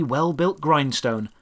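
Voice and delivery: ordinary talk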